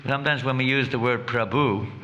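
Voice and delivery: usual speaking voice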